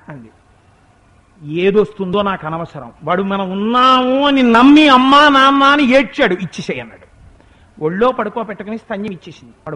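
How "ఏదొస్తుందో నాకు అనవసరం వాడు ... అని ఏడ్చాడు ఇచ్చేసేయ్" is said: firm, louder insistence; insistent Shiva. Retold or imitated. Shiva insists to Parvati, loud and firm. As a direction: press her to give it now.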